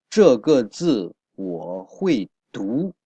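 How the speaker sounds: slow and deliberate, each syllable clear; slow model reading. Shown as a demonstration. Teacher modelling the example sentence for students, slow. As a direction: read it out slowly for the class. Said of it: "teacher models the sentence slowly".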